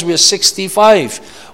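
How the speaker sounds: ordinary talk, nothing marked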